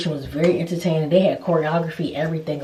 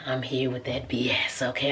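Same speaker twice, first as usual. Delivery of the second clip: low conspiratorial whisper; hushed aside; 5 semitones lower, 8 dB quieter than usual. Real, not acted. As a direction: let them in on a secret.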